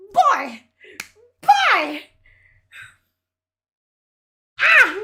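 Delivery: loud, high exasperated cries; outraged outburst. Real, not acted. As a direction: blurt it out in sheer outrage. Outraged outbursts while reading article.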